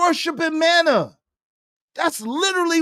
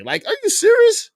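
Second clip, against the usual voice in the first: incredulous; 10 semitones higher, 3 dB louder than usual. Real, not acted. Incredulous reaction to own mockery.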